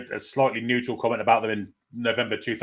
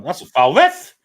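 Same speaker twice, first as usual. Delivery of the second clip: loud, high appeal to the referee; shouting at the ref; 6 semitones higher, 10 dB louder, much slower than usual. Real, not acted. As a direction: yell it at the ref.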